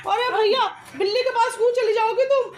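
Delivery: high and loud, laughing in surprise; startled laugh at the escaping chickens; amused shriek at the animals; laughing exclamation. Real, not acted. Animals burst out, mother reacts.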